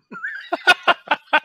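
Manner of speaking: big, high courtesy laugh; polite laugh. Real, not acted. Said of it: "the best courtesy laugh I've ever heard".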